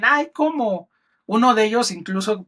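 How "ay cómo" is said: scoffing disbelief pitched up high; imitating the teasing colleagues; mock disbelief. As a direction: play the colleagues laughing it off in disbelief. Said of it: "se ríen porque dicen".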